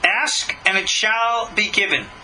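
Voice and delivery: ordinary delivery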